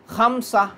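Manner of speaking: खमसा said with the final sound dropped, ending on a plain "a"; teacher demonstrates खमसा with the pausal ending; pausal Arabic form. Shown as a demonstration. Teacher showing students the pausal Arabic form खमसा. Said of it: "खमसतुन नहीं पढ़ते".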